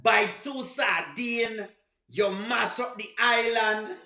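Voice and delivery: slow mock of an effortful Jamaican accent; dragged-out fake Jamaican; bad Jamaican accent. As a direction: stretch each word in put-on Jamaican. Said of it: "work we have to do for sound like that".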